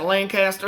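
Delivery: Lancaster with a full "caster"; outsider's pronunciation. Retold or imitated. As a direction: say Lancaster as LAN-CAST-er. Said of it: "she corrected me all the time".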